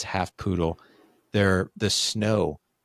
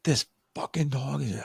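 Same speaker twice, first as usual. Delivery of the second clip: higher-pitched mock yell, held back in volume; retold yelling; 6 semitones higher than usual. Dad yelling at the dog over the couch, mock furious. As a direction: re-enact a furious rant without full volume. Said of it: "I was yelling at the dog".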